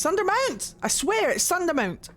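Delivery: high-pitched exclamation, insisting on the word; insistent protest. Real, not acted. Streamer disputing how the game says Sundermount, high insistent protest.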